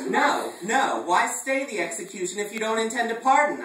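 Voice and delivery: high, loud outraged protest; hammed-up indignant yell; indignant cry. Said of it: "I cried".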